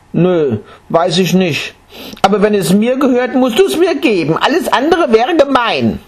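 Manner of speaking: put-on high child's voice, getting insistent; a stubborn boy insisting it's unfair. Boy arguing with the monk about his inheritance.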